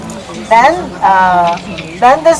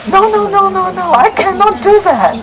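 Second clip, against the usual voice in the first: high, quick no no no; young man's startled high-pitched refusal; flustered refusal; 10 semitones higher than usual. Acted. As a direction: recoil in alarmed protest.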